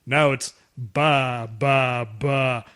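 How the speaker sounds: very slow, drawn-out bleating syllables; quoted goat storyteller. Retold or imitated. Uncle telling his goat farm story, slowly drawn out. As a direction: drag out each goat noise, deliberately. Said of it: "He goes".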